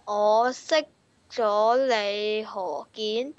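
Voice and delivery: normal talking voice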